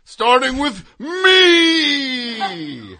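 huge high scream, last word stretched out long; dramatic yell. Acted. Man announcing he will burn it down, dramatic drawn-out yell. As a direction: bellow it slowly, then hold a screamed final word.

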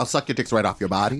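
low, dramatic joking growl; mock deep voice. Co-host topping the story with a low mock line. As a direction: drop the voice way down for a joke.

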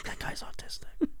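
whispered aside, no voice. Retold or imitated. To a friend about a TV show, a whispered aside. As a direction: breathe it out unvoiced. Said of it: "I'm like".

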